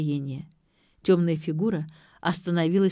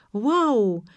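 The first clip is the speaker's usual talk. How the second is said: higher-pitched wide-eyed admiration; delighted; 8 semitones higher, 3 dB louder than usual. A narrator's reading. Vik sees the stage light up, delighted. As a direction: marvel at it with raised pitch. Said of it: "восхитился Вик".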